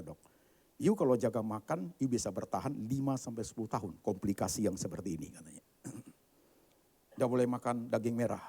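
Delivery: normal talking voice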